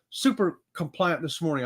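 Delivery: ordinary talk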